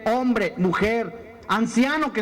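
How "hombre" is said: normal talking voice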